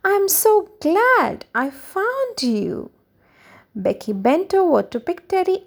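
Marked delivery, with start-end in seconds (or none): 0.0-2.9 high, slow, joyful storybook exclamation; delighted child, pitched well up; happy cry. A narrator's reading. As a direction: draw it out with happy relief. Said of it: "cried Becky happily".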